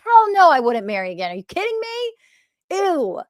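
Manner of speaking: high, loud mock disgust at the idea of remarriage; imitating the wife's grossed-out no, pitch jumping up; disgusted refusal. Wife refusing to remarry, playfully disgusted and high. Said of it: "She's like".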